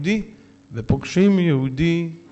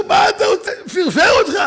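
high, loud, laughing yell at the priest; the furious king shouting; 13 semitones higher, 6 dB louder than usual. Acted. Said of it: "המלך התחיל לצעוק". (צעקה גבוהה ורמה של המלך)

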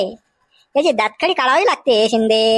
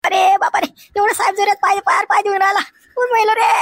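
high-pitched pained wail; acted shout of pain; crying out in pain; 8 semitones higher than usual. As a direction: yelp as someone treads hard on you. Man cries out in pain.

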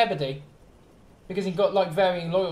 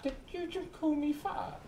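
higher, softer voice for a hurt engine; offended engine; 6 semitones higher, 12 dB quieter than usual. Acted. Engine reacting to fat shaming, mock-offended. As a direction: play the offended engine, small and high. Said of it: "fat shaming Rick when he comes in".

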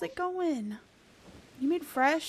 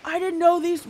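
surprised gasp; 3 semitones higher, 8 dB louder than usual. Real, not acted. Surprised to find new shinies.

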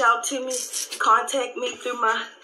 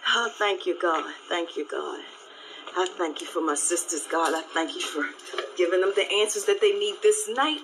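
prayer; 4 dB quieter than usual. Acted. Closing prayer to God for her sisters, soft and hushed. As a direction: pray it quietly to God. Prays aloud on her live.

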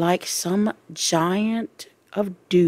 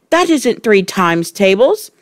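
loud, raised protest; crying out; 4 semitones higher, 6 dB louder than usual. A narrator's reading. Wilfred disputing the times tables, crying out. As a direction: protest as a wronged pupil. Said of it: "Wilfred cried".

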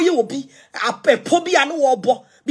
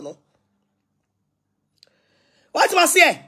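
ordinary talk

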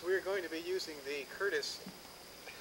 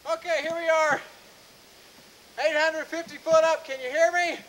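loud, high calling out; shouting down; 10 semitones higher, 10 dB louder than usual. Real, not acted. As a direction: call down from way up. Calling down from tower top.

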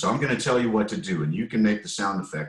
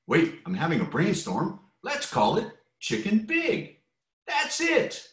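much higher, slow eager chicken voice; high excited chicken; 12 semitones higher than usual. Acted. Reading aloud, a chicken excitedly names the book. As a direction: squeak with sudden inspiration.

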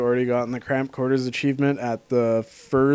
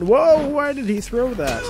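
startled; 11 semitones higher, slower than usual. Real, not acted. Enemy throws something in game.

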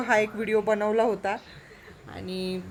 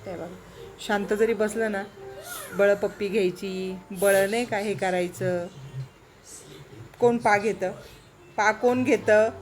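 ordinary talk, nothing marked

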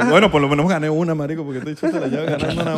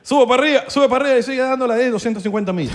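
high and loud, hammed-up directions; a hammed-up Venezuelan in Doral pointing a lost gringo; mock local giving directions; 10 semitones higher, 4 dB louder than usual. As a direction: play the Doral local shouting the way.

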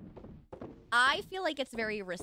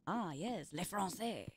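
usual speaking voice

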